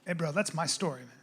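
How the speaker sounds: hushed, low aside; discreet objection. Retold or imitated. Friend quietly claims his stolen story, low hushed voice. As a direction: murmur it privately to your friend. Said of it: "he goes".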